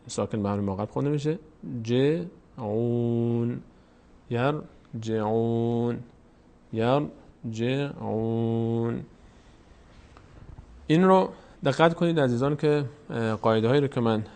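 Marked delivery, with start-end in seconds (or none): 1.8-9.0 very slow, syllables split and last one held; recitation drill. Shown as a demonstration. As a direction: draw out the final -uun on a level note. Teacher drills drawn-out recitation.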